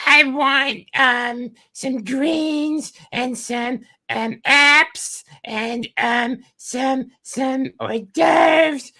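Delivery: slow, very high falsetto drone; droning girl. Acted. Date ordering food on and on, droning girl voice. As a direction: drag out each item as a mock girly date. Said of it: "The girl just fucking drones for hours".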